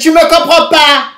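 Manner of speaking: high, loud retold protest; indignant shout. Arguing that her date doesn't understand, indignant shout. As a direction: throw it back loudly and high.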